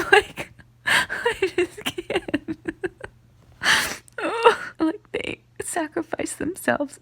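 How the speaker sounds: high sobs, words dragged out slowly; real weeping, barely getting words out; crying. Real, not acted. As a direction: break down mid-sentence. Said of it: "I'm crying because they just sacrificed".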